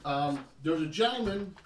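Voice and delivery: ordinary talk, nothing marked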